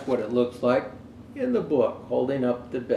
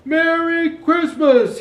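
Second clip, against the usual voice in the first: much higher, louder, slow shout; 11 dB louder, slower than usual. Acted. Conductor shouting from the departing train, long call. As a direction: call it long across the distance. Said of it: "he shouted".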